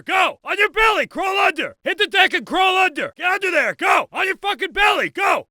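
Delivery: high-pitched full shout, urgent commands; drill-sergeant shouting. Acted. Instructor driving a recruit room to room, shouting. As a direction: bellow rapid-fire orders at a recruit.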